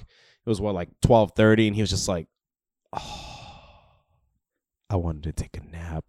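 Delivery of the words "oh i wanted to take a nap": low, very soft groggy groan; sleepy reluctance. Retold or imitated. Friend begging off helping with the couch, sleepy and reluctant. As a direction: drag it out quietly, wishing for that nap.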